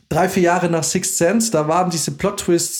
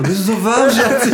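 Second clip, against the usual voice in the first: put-on drunk voice; 6 semitones higher, 4 dB louder than usual. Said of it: "völlig besoffen".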